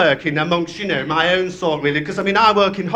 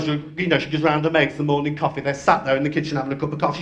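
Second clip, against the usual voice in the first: ordinary talk, nothing marked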